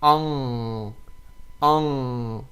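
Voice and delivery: Ang hummed through the nose, vowel nasalized; nasal ang. Shown as a demonstration. Teacher demonstrating nasal sound to students. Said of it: "pronounce that from your nose".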